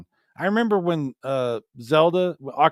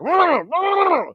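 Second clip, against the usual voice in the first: high, loud yappy dog noises; mock angry dog barking; mad dog imitation; 6 dB louder than usual. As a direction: bark it like an angry little dog. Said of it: "the dog would be like".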